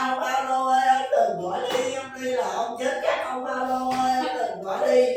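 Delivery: loud imitated begging, drawn out; tearful pleading. Crowd begging Paul not to go, loud pleading. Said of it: "người ta khóc lóc".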